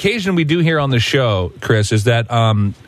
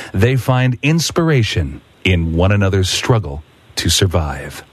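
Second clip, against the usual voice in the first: movie trailer voice; 5 semitones lower than usual. Acted. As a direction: boom it out like a blockbuster trailer. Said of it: "assume, uh, the movie trailer guy".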